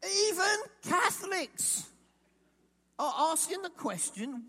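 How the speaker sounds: slow, high, loud cry of even Catholics. Acted. Preacher crying out the repeat to his congregation, loud and slow. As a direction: cry it out after the long pause.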